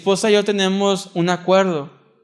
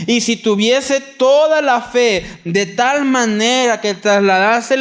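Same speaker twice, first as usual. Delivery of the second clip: higher, louder, slow solemn proclamation dropping to a hush; scripture reading; 5 semitones higher, 4 dB louder, slower than usual. Acted. Preacher reads Corinthians thirteen, building then hushed. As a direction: build the verse then let it fall.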